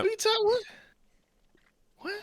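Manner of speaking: very high squeaky "what?"; hammed-up baffled falsetto; mock confusion. Husband mocking the confused wife in falsetto.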